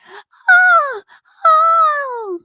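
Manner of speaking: high, loud shrieks; piercing cries of alarm; repeated screams. Acted. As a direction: scream twice in storybook terror. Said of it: "heard her screams".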